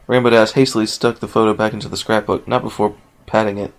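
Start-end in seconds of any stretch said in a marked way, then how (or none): none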